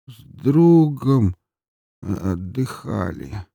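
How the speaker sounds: put-on halting, dragged-out drunk voice; slow drunken answer. A narrator's reading. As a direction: grope slowly for the words. Drunk answers questioning slowly.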